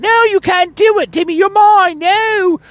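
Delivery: very high falsetto, pleading and possessive; ranting jealous woman. Pretend. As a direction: shriek it like a jilted rival at the altar. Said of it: "she'll start ranting and raving".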